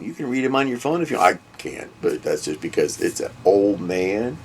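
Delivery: normal talking voice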